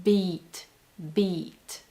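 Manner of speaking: "beat" with a fuller, longer vowel; comparing B sounds. Shown as a demonstration. Coach comparing languages for viewers, English-style pronunciation. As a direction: draw "beat" out a little.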